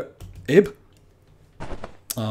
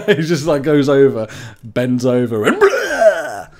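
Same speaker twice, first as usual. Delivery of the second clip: retching imitation; 6 dB louder, slower than usual. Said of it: "thought he was gonna throw up".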